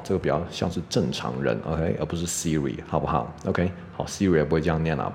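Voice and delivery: ordinary talk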